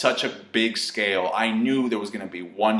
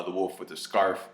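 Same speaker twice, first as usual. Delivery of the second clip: ordinary talk, nothing marked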